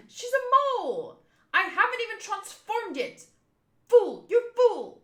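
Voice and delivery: high, loud, hammed-up outrage; indignant yelling. Mole woman berates someone as a fool, acted indignant yell.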